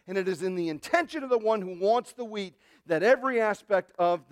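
loud, hammering sermon delivery; emphatic, driving pulpit voice; forceful preaching. Real, not acted. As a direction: pound each repeated phrase home to the congregation.

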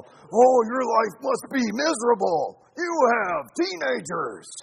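put-on loud, slow, pitying gasp at teenagers; mock pity. People pitying the preacher's teenage household, loud mock pity. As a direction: pour on fake sympathy. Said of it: "they go".

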